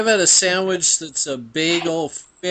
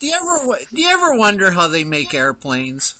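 Philip impersonation; 5 semitones higher, 4 dB louder, faster than usual. Pretend. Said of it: "the best Philip impersonation".

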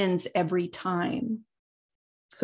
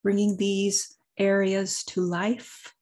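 guided meditation. Acted. Leads a meditation, long pauses.

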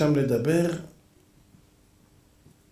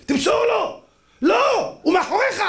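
high, frantic mock shout at the TV; football fan yelling. Fan urging a player to pass, shouting high. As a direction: yell it as if the player could hear.

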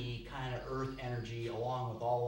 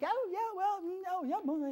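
high singing up-and-down "oh, yeah, well"; sing-song earth person. Retold or imitated. Teacher imitating earth people's high sing-song way of talking. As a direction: lilt it like a seesaw. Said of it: "kind of almost like they're singing".

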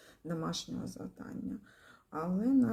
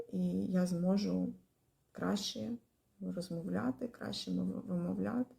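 ordinary talk, nothing marked

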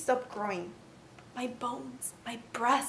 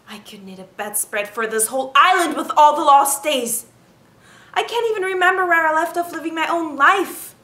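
bitter outburst; 8 dB louder than usual. Acted. Bitter lament, loud.

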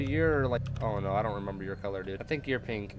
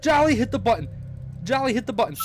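high, loud frantic screaming; panicked shouting; 6 dB louder, faster than usual. Real, not acted. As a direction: scream orders in real panic. Begging drone to hit button.

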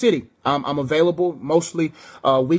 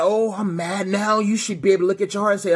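ordinary delivery

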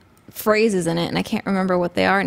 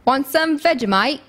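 playful put-on Aussie accent; Australian accent; 4 semitones higher than usual. Shown as a demonstration. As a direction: try an Australian accent, pitched up a little. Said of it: "can you do an Au-, Australian accent?".

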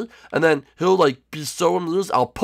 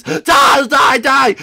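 loud, high yelling; hammed-up loud war cry; battle shout; 9 semitones higher, 7 dB louder, much slower than usual. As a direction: yell it as you charge. Attacking Bumblebee Basketball Man.

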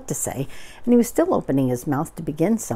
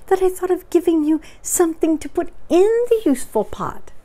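very high, piping delight; happy Piglet; 12 semitones higher, 4 dB louder, faster than usual. Acted. Piglet is glad of his own gift, piping happily. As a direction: squeak it proudly, pitched right up.

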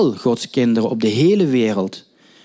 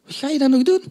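much higher, rising in disbelief on doen; disbelieving repeat; 11 semitones higher, faster than usual. Retold or imitated. Preacher voicing doubting disciples, incredulous high rise. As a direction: repeat the question as if it makes no sense. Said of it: "En dan zou je kunnen denken".

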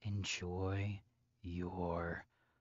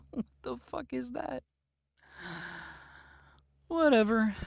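higher, fast sneer; high mocking scorn; 12 semitones higher, 4 dB louder, faster than usual. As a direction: toss it off with disdain. Scorns the bracelet.

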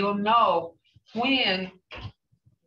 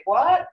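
WHAT with a high rising tune; loud, rising "What?" for a repeat; didn't-hear question; 7 semitones higher, 5 dB louder than usual. Shown as a demonstration. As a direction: ask "What?" as if you missed it, pitch shooting up. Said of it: "when somebody says something and you can't hear".

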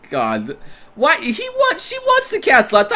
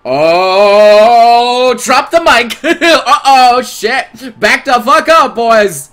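loud, high whoop of excitement; hyped reaction; 4 semitones higher, 6 dB louder, much slower than usual. Real, not acted. Streamer cheering the heroine's comeback, hyped and loud. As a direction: draw out the opening, then crow it loudly.

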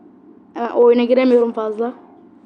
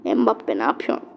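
much lower, softer dismayed question; deflated groan; 11 semitones lower, 7 dB quieter than usual. Real, not acted. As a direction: sink into disbelief. Dismayed at player. (hayal kırıklığıyla)

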